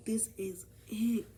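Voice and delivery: slow, drawn-out whisper; whispered relish. Retold or imitated. Finding the perfect quote to send, slow whisper. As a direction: savor each word in a whisper.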